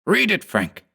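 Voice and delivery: high, urgent command. A narrator's reading. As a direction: order him to read it. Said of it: "he commanded".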